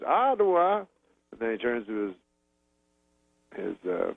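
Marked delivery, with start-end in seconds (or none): 0.0-0.8 high questioning echo in impression; Bill Cosby. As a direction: repeat it high, as a comedian's question. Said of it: "He goes".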